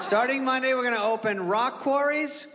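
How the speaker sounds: deadpan official reading absurd news; hammed-up governor announcing. Governor again, absurd list.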